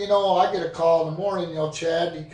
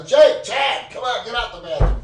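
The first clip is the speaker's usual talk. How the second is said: lauter, hoher Ruf zur Tür hin; calling out; 7 semitones higher, 5 dB louder than usual. Retold or imitated. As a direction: hol ihn drängend aus dem Bad. Yelling for friend through bathroom door.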